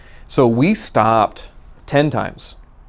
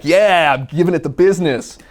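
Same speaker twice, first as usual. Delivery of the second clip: excited boast; 7 semitones higher, 3 dB louder than usual. Retold or imitated. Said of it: "I'm like".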